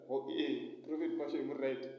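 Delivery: ordinary talk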